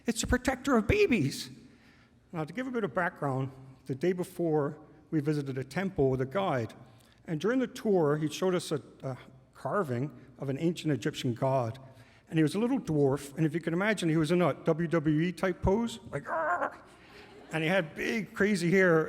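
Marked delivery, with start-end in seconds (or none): 0.0-1.5 high-pitched, gushing mother imitation; really excited mother. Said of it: "my mother got really excited". 16.3-16.7 high mock "arr" growl; mock wrestler roar. Mimics god in wrestling pose.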